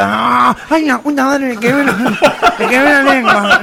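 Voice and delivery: nasal yelping over a burnt tongue; imitated cleft-palate complaint; gangoso fire-eater. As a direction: garble it through the nose in mock pain. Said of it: "que era gangoso también".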